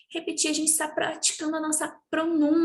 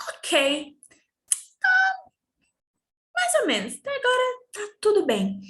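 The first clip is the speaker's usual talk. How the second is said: much higher animated voice; higher, louder lively aside; 9 semitones higher, 4 dB louder than usual. Shown as a demonstration. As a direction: perk up and lift the voice. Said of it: "teacher playfully voicing so-so mood".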